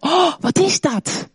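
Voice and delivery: a hint of a suspicious child; puzzled kid. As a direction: ask it like a wary child peering at strange food. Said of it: "een aantal van de kids zou denken".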